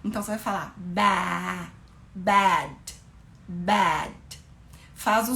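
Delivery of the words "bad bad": bad with an e sound but the mouth open as for a; English bad. Shown as a demonstration. Teacher showing bad to a viewer on a live. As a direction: open the mouth wide on bad while saying e. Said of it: "som de E, só que fazendo a boca de A".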